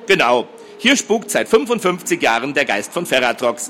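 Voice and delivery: plain reading voice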